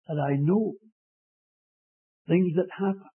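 usual speaking voice

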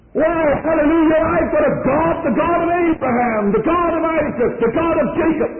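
preacher's joyful shout of praise; exultant preaching. Acted. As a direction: lift the congregation with joyful praise.

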